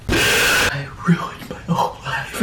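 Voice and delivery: half-laughing hushed wail; giggling whisper of mock despair.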